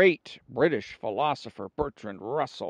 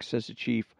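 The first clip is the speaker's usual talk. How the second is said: ordinary talk, nothing marked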